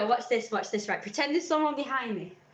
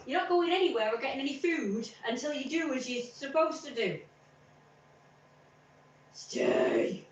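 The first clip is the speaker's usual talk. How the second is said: higher, firm dog command; 5 semitones higher than usual. Retold or imitated. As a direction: order the dog to his bed. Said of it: "I said".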